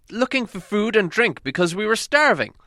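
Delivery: high, loud desperate insistence; a hungry prisoner repeating his plea to the king, high; insistent Thorin. A narrator's reading. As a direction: insist on it again, voice high and strained.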